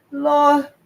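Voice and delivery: high, loud crying plea; fearful plea. Acted. Frightened boy begging God while crying, high and loud. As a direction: cry out to God in terror. Boy begins to cry in fear.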